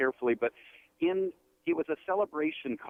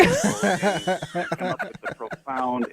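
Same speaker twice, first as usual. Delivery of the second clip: teasing through laughter; 5 semitones higher, 6 dB louder, much slower than usual. Real, not acted. Teasing Milton about the break.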